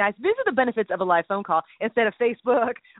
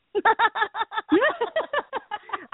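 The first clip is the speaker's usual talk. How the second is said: high giggle; 12 semitones higher than usual. Real, not acted. High laugh.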